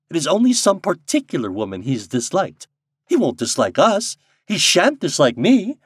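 lively, light-hearted bravado; merry. A narrator's reading. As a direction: boast gaily about winning someone over. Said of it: "cried Helen Wingate merrily".

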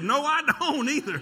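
high, loud panicked no; mock protest. Boy refusing in front of the church, high loud protest. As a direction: blurt the refusal out in alarm. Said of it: "I almost said".

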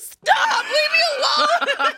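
high, loud laughing yell; mock shout. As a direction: shout at the teasing husband, half laughing. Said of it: "I was like".

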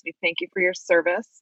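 normal talking voice

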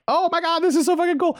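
high, thrilled gushing; excited squeal. Retold or imitated. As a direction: squeal with delight. Excited quoted thought, very high.

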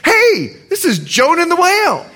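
high, laughing "hey" thrown at a stranger; ribbing a guy in a fish costume, laughing; joking excitement. Retold or imitated. Pastor teasing a stranger in a fish costume, high and laughing. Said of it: "and I said".

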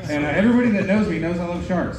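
ordinary talk

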